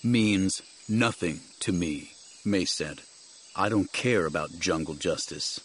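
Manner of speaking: low, controlled, grave voice; resolute Jedi master. Acted. Jedi rejecting jungle justice to companion, gravely resolute. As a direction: state it low, with quiet resolve.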